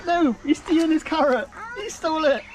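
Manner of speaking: high, excited protest; squealing at the greedy animal. Real, not acted. Animal snatches the carrot.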